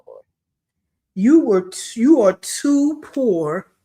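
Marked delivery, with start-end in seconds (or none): 1.1-3.7 mock blunt. Shown as a demonstration. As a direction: spell it out bluntly. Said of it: "I'm saying it like this".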